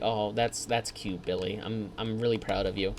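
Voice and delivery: a hint of a mother humoring her kid; mom reply. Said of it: "It's like".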